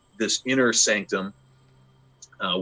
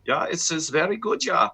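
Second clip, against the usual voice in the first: quick sketch of a German accent, pitched higher; 9 semitones higher than usual. Shown as a demonstration. DM giving the players a sample German NPC accent. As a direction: toss off a light German accent. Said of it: "German being a very common one".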